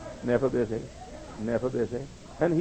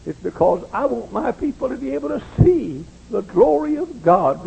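raised, insistent pulpit voice; fervent preaching; 9 semitones higher, 8 dB louder than usual. Real, not acted. Preacher tells his people he longs for them, fervent and raised. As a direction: push it higher with real conviction.

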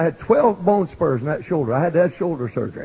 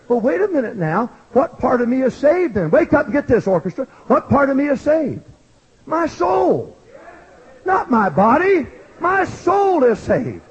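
raised, fervent preaching voice; full-force preaching; 5 semitones higher than usual. Acted. Preacher testifying to the congregation, fervent. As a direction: preach it out with fire.

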